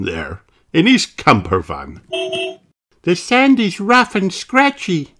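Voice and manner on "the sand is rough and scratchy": put-on very high young kangaroo; child voice, far up in pitch; Kylie. A narrator's reading. Kylie describes the sand, high-voiced. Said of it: "says Kylie Kangaroo".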